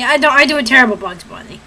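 usual speaking voice

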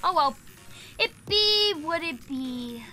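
usual speaking voice

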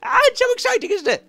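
very high, loud gushing squeal; excited squeal. Pretend. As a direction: squeal with delight as if it's the best day ever. Voicing thrill over golem moving.

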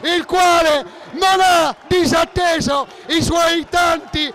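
breathless italian goal commentary. Real, not acted. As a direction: scream the goal call, then ride it down. Goal scored, commentator erupts.

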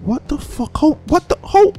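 high-pitched, broken questions; baffled disbelief. Real, not acted. Streamer puzzling over the betrayal, baffled. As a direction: sputter short questions, pitch jumping up.

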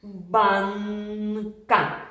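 банка held long with н stretched; teacher slowly sounding out банка; drawn-out word. Shown as a demonstration. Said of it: "Ще раз".

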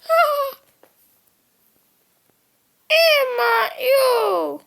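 high loud shocked cry; startled yell. Real, not acted. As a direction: yelp in fright. Startled in game.

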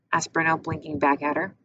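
ordinary delivery

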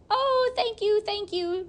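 high, squeaky little frog voices; grateful frogs. Acted. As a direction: gush thanks like tiny frogs.

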